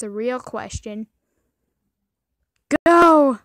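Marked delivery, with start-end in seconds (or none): 2.9-3.4 loud high cry of go; shout. Real, not acted. Gamer yells at his sea creature to go. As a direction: yell it out to cheer it on. Cheering on a swimming fish.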